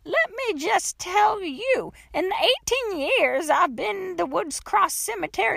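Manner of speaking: put-on high, emphatic folksy old-lady voice; indignant old woman. A narrator's reading. Old woman lectures trapped girl, high and indignant. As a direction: set her straight like a batty old woman.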